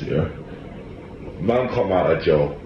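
usual speaking voice

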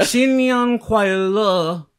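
very drawn-out, high Chinese greeting; lingering attempted Chinese. Shown as a demonstration. Cohost retries his Chinese greeting, lingering too long. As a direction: stretch the last syllable of "Xin Yang Kuai Le" far too long. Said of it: "That, that lingered a bit".

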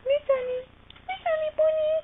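very high, loud cooing call; pet talk. Real, not acted. Owner calls Sunny bunny over, high loud pet talk. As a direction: squeal a greeting to a beloved pet.

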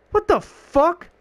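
high exasperated disbelief; baffled. Real, not acted. Gamer who cannot hurt the boss, high baffled cry. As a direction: throw the pitch up in disbelief.

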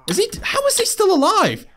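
high, squeaky disbelief; high-pitched baffled exclamation; incredulous. Real, not acted. Opponent survives the beating.

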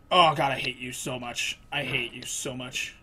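low, soft, weary muttering; defeated exasperation. Real, not acted. Player cursing the game, quietly defeated. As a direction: say it low, drained and fading.

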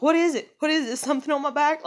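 high, loud, panicked questions; retold panic. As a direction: ask in a rush of alarm. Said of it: "I started freaking out".

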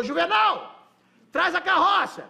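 calling out. Retold or imitated. The farm owner calls his foreman Juvenal to bring the cart, loud. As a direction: bellow across the farm for Juvenal.

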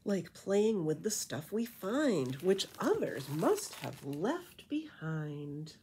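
lilting rhymed storybook read; reading to children. A narrator's reading. Reading a bat beach story to kids, lilting rhyme. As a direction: read it like a bedtime picture book. Reading rhyming picture book aloud.

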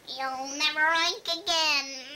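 loud witch proclamation; the witch voiced with force; witch's curse. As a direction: pronounce a storybook curse, loudly. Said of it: "the witch sprang out of nowhere".

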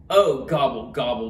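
low, sinking turkey moan; moaned gobble. A narrator's reading. Turkey sent back to the farm, sinking moan. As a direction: moan it, dropping in pitch. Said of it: "moaned Turkey".

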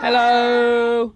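put-on high, loud kid greeting; childlike hello. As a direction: chime in like a child in the audience. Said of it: "I'm gonna be a child for this one video".

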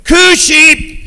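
loud high call across the meadow; shepherd yelling for his lamb. Acted. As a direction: call out to the lost sheep. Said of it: "And he's yelling".

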